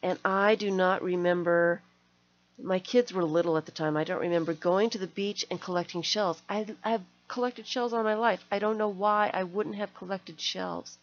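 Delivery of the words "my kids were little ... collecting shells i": usual speaking voice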